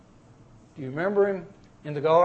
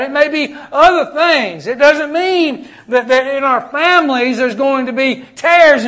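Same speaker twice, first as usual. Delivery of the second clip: raised, loud pulpit preaching; preaching at full force; 7 semitones higher, 9 dB louder than usual. Acted. Preacher urging his congregation on, full-force preaching. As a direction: drive it home to the congregation, louder and higher.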